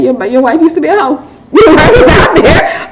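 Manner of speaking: laughing through a playful tease. Real, not acted. Wife teasing her husband about her past, laughing. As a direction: tease him while cracking up.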